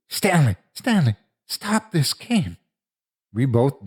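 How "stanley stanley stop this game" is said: high-pitched frantic calling out; screaming. A narrator's reading. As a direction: call desperately for help. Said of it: "I screamed too".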